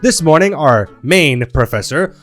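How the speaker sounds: ordinary delivery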